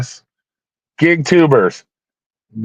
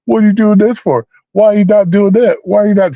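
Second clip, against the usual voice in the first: imitating freaking out; 8 semitones higher than usual. A streamer mimics his past self yelling at viewers, high and exasperated. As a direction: replay his old blow-up at the viewers, all indignation. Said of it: "I'd be freaking out on y'all".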